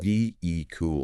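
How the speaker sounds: "V E, cool" with silent H; correct pronunciation. Shown as a demonstration. Co-host repeating the fix, correct pronunciation. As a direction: say "V E, cool" as vee-ick-ul. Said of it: "But it's V E E".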